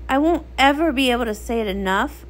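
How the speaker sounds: plain reading voice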